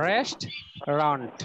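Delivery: "রেস্টুরেন্ট" slowly as two beats, rest... ront, low; English pronunciation, split. Shown as a demonstration. Teacher showing the syllable count, "রেস্টুরেন্ট" as slow rest... ront. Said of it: "এটার উচ্চারণ কি দেখো".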